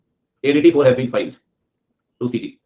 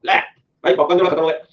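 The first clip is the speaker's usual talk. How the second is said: higher, louder mock cheer from the balcony; cheering student; 4 semitones higher, 3 dB louder than usual. Balcony students cheering the teacher, hammed-up and loud. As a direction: egg the teacher on like a rowdy fan. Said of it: "कहते".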